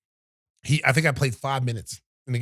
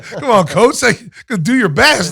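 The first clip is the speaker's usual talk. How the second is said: high, loud mock pep talk; 7 semitones higher, 11 dB louder than usual. Mocking the coach's empty pep talk in a high, loud sneer.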